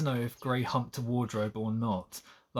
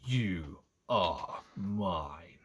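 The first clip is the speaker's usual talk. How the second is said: very slow, low snarl, each word weighted; low, menacing claim of ownership; possessive snarl; 5 semitones lower, 4 dB quieter, much slower than usual. A narrator's reading. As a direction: snarl it word by word, drawn out and deep. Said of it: "he snarls, emphasizing each word".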